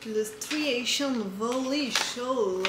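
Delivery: slow put-on English accent on the name. Knitting vlogger jokes about her English accent on the name. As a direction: say it like a Brit, drawn out. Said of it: "Vous aimez bien mon accent anglais".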